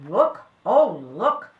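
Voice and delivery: loud, slow cry of amazement; astonished helper. A narrator's reading. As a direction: call out, stretching each look in wonder. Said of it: "said one of the helpers".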